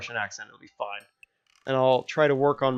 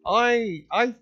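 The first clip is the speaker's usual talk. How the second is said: high-pitched stammering reprimand that gives up; flustered dad; 7 semitones higher, 5 dB louder than usual. Acted. Dad giving up on policing his daughter's language, high and flustered. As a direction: start loud and scolding, ease into resigned stammering.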